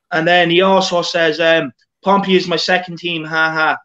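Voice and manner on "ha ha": ordinary delivery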